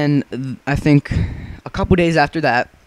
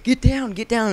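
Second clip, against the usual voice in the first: higher-pitched hushed warning; urgent hushed call; 8 semitones higher than usual. Retold or imitated.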